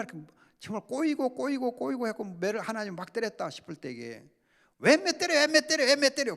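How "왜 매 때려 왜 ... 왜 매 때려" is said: high, loud whiny protest against being punished; mock indignant protest. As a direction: whine loudly like a resentful child. Sinner protesting God's punishment. (벌 받고 대드는 신자처럼 높고 큰 소리로 투덜대는 항의)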